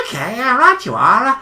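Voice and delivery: friendly stranger on a bike; cheerful cyclist voice. Acted.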